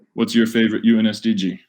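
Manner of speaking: ordinary talk, nothing marked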